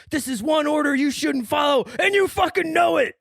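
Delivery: high, loud movie-line shout; rising into a loud dramatic yell; intense quote. Retold or imitated. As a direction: belt out the order as Billy Bob. Billy Bob's line acted in retelling.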